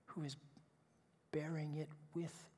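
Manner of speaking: hushed, breathy whisper of comfort. Real, not acted. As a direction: whisper it gently, as a promise. Tender whispered close of sermon.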